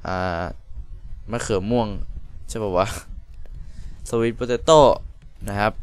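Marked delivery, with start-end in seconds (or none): 4.1-4.8 potato with a rising last syllable; put-on rising voice. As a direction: let "potato" lift at the end. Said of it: "ผมต้องทำเสียงอย่างนี้ด้วยวะ". (ลากท้ายคำ potato ให้เสียงขึ้น)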